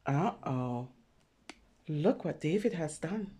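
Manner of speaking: plain reading voice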